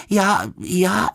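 startled, high broken start; nervous little bear's stutter; stammering. A narrator's reading. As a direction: stumble on the word, flustered. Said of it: "zakoktal se".

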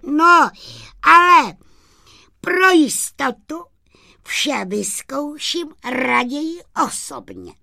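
high sprite voice, loud start then easing off; high-pitched, halting second thought; hesitant little sprite. Acted. Sprite hesitates, decides to test it.

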